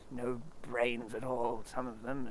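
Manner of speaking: soft, slow grumble under the breath; muttered. Acted. Eeyore grumbling about his friends, muttered under his breath. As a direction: grumble to yourself. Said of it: "as he muttered to himself".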